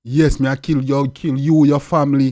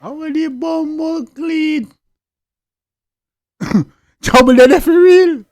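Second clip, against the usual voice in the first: laughing out the words, higher and louder; cracking up at the clip; real laughter breaking through the line; 11 semitones higher, 7 dB louder, slower than usual. Real, not acted.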